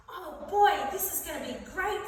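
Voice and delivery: high-pitched gleeful anticipation; eager excitement. Acted. Fart joining three boys on the bus, excited. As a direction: bubble over with eager delight.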